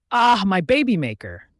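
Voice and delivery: high, loud cry of pain; shriek. A narrator's reading. Boy kicked in the groin, shrieking. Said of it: "he shrieked".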